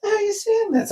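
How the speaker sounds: high, soft mock disbelief; baffled reply. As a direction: squeak out puzzled disbelief, retold. Acts out own baffled reply.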